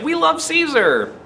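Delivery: high, adoring mock cheer; a hint of a fickle fan's devotion; gushing crowd. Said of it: "they were like".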